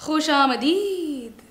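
slow, drawn-out greeting; lingering, showy salutation; theatrical welcome. Acted. Theatrical welcome to a paying customer.

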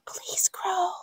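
soft hopeful whisper; whispered plea. Acted. Girl begging her seeds to grow, whispered plea. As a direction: breathe it as a wish.

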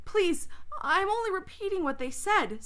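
high-pitched, flustered protest; stammered plea. A narrator's reading. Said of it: "stammered Edmund".

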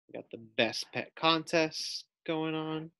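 usual speaking voice

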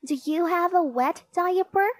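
put-on high-pitched little girl's question; child asking. A narrator's reading. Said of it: "asked D W".

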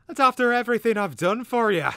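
very high, very loud squawking outrage; angry shout. A narrator's reading. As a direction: shriek it as a wronged bird. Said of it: "the cater bird shouted angrily".

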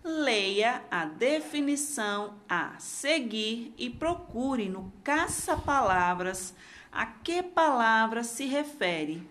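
normal talking voice

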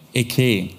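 "Eke" said as a Hebrew word; Hebrew pronunciation. Shown as a demonstration. Preacher showing the congregation the Hebrew for "I am", Hebrew pronunciation. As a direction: pronounce "Eke" roughly the Hebrew way. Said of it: "so ungefähr ausgesprochen".

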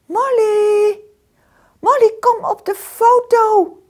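high, loud call down the hole. A narrator's reading. Dog calling Molly down the molehill, high and loud. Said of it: "roep ik".

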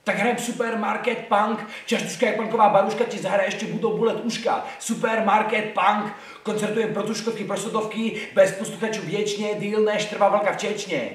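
high-pitched punk slam chant; driving, high rhythmic spoken-word; slam poetry performance. Shown as a demonstration. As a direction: belt out the rhymes in a high voice. Performs his slam text as contrast.

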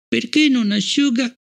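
put-on high small child's voice, curious; a little girl asking, wide-eyed; child Fallon. A narrator's reading. Child Fallon asks Bliss about the sea below, high and curious.

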